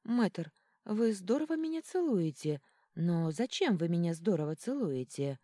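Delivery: ordinary delivery, nothing marked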